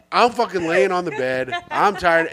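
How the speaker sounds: ordinary talk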